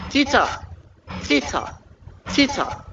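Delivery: "Tita" said high with a falling tune for students to repeat; model pronunciation. Shown as a demonstration. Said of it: "You are doing well".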